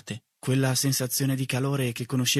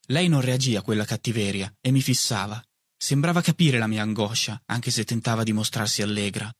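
plain reading voice

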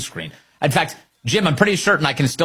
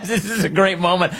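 laughing while talking, higher; 7 semitones higher than usual. Real, not acted. Host laughing at Trump's vegan line, higher. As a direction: chuckle through the words.

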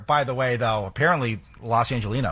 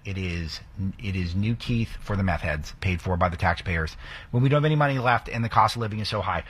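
ordinary talk, nothing marked

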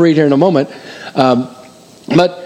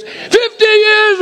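delighted exclaim; much slower than usual. Real, not acted. Calling out hands, counting up years.

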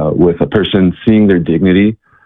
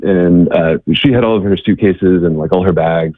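ordinary talk

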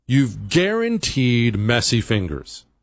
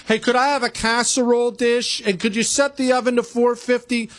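higher mock-whiny voice imitating Chris; 9 semitones higher than usual. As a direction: play a fussy home cook asking for a casserole dish, pitched up. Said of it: "What, are you gonna borrow the oven?".

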